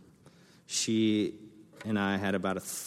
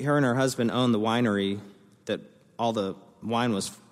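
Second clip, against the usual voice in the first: ordinary delivery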